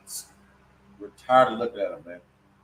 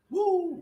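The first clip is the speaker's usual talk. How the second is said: preacher's whoop. Real, not acted.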